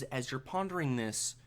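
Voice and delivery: ordinary talk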